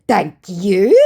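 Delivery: "Thank you?" loud with a rising end; questioning. Acted. Scammer echoing the host's refusal, "Thank you?" as a question. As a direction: lift "you?" into a puzzled query. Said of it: "Question mark, question mark".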